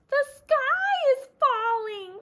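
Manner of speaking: high, loud, slow panicked hen cry; frantic cry. Acted. Henny Penny warning the others, high panicked cry. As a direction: wail it out in terror. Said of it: "cried Henny Penny".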